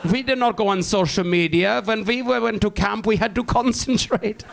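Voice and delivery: lightly voiced old German veteran; old German accent. Old Nazi veterans reminiscing.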